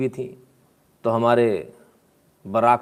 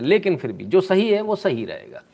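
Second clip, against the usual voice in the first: ordinary talk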